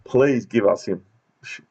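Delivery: usual speaking voice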